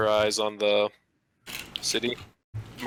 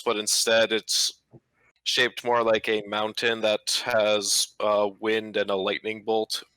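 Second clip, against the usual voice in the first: usual speaking voice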